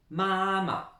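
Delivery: "mama" with a long, high first syllable and a light second; Chinese first tone. Shown as a demonstration. Teacher introduces the Chinese word for mum, high first tone. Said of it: "On insiste bien sur la première syllabe".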